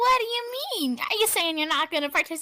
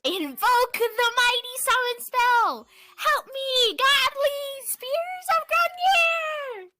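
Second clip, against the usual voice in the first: higher and slow, stretched like a ritual chant; grand spell incantation; 8 semitones higher, slower than usual. Acted. Headmaster summoning spears against the student, higher slow grandiose incantation. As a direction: call down the spears as a pompous villain.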